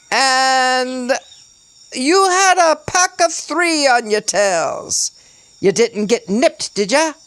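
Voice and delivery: high, drawn-out British declamation; over-the-top British character voice; forced British accent. A narrator's reading. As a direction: proclaim it high in hammy British. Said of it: "His accent was forced and over the top".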